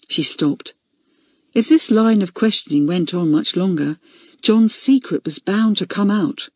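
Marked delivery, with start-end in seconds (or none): none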